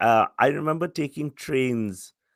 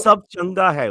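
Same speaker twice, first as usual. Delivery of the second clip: attempted Punjabi; 5 semitones higher, 7 dB louder than usual. Shown as a demonstration. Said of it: "which I don't think is correct".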